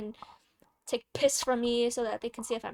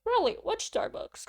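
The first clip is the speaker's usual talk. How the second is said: eager man; 10 semitones higher, slower than usual. Retold or imitated. Man in ambulance asks eagerly.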